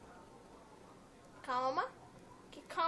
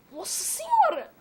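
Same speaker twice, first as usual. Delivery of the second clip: much higher and louder, slow cry of shock; shocked exclamation; 11 semitones higher, 9 dB louder than usual. Real, not acted. Gamer crying out at a sudden enemy, loud and high. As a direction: blurt it out in disbelief.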